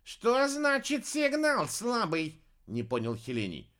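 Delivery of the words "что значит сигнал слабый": puzzled high voice. A narrator's reading. Puzzled ruler voice.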